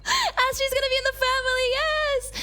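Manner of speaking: high, overjoyed cheer; happy squeal. Real, not acted. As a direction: squeal the good news. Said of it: "that made me so happy".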